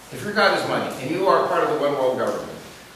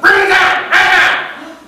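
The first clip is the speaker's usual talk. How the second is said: much higher, much louder yell; 14 semitones higher, 9 dB louder, slower than usual. Retold or imitated. Pastor yelling at him from the stage, loud. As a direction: bellow the order out. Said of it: "So he yelled out".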